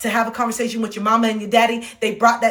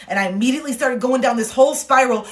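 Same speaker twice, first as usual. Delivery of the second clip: ordinary talk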